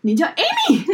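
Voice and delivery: high, loud, incredulous question; surprised caller. Retold or imitated. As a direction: mimic the caller's disbelief, laughing. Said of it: "对方就说".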